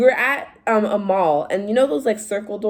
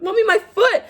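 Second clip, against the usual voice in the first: high, loud whine with a laugh; whiny child; 13 semitones higher, 5 dB louder than usual. Retold or imitated. Daughter whining to her mom about her hurt foot, high mock wail. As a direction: play the hurt kid wailing to mom.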